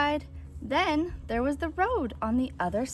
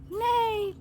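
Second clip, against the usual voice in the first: horse neigh; 10 semitones higher than usual. A narrator's reading. Farm animals cheering Blue.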